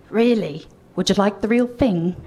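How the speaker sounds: lightly imitated thick Cockney accent; a touch of Cockney rock star; Billy. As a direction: make the offer in mock Cockney. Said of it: "in his thick Cockney accent".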